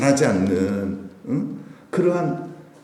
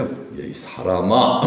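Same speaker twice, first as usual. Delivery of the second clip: slow, lower-pitched reproach; genteel reproof; 6 semitones lower, much slower than usual. Retold or imitated. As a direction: draw it out as a dignified scolding. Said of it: "좀 책망하신 거예요". (점잖게 길게 빼며 나무라는 말투)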